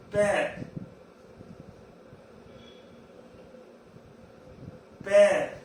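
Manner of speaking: "père" with an open "eh"; isolated, emphatic "père" for the class; open è sound. Shown as a demonstration. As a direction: model "père" firmly as "pair". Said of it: "A sound".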